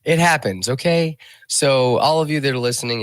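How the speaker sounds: high, loud, fast playful address; excited. Real, not acted. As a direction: announce it brightly to listeners.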